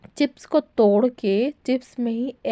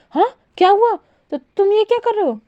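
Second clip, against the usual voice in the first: startled; 10 semitones higher, 5 dB louder, faster than usual. Acted. Wing startled by closed laptop.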